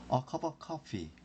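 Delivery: "a cup of coffee" run together slowly as one word, f made with teeth on lip; linked, slow. Shown as a demonstration. Teacher drilling linked "a cup of coffee" for students, slow and smooth. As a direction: glide "a cup of" into "coffee" without a break. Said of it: "혀를 깨무는 형태로 발음이 돼서".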